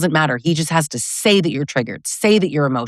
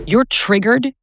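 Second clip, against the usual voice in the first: mocking dismissal; 6 semitones higher, slower than usual. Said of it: "that phrase".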